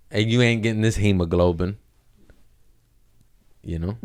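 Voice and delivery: ordinary delivery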